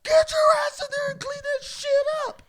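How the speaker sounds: very high, very loud order; angry scolding shout. Retold or imitated. Housemate ordering him to clean up the mess, furious acted yell. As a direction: scream the order at a culprit.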